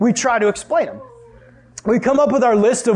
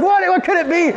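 high-pitched frantic question; panicked yelling; 10 semitones higher than usual. Acted. Family yelling out ideas about the intruder, panicked. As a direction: stammer it out in mock alarm. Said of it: "we're all yelling out".

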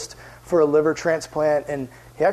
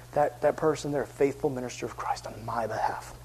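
low, very soft, falling toward a whisper; hushed testimony; 4 semitones lower, 9 dB quieter than usual. Acted. Preacher voicing the one who was befriended, hushed testimony. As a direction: let it sink into breathy earnestness.

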